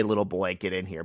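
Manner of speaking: low, hushed creepy summons; mock a creepy man luring someone in, softly; creepy invitation. Shown as a demonstration. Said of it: "He doesn't say".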